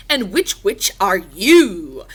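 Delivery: loud, slow, lightly acted question; skeleton riddler voice. A narrator's reading. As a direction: ask slowly and loudly, drawing it out. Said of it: "the pumpkin skeleton asked".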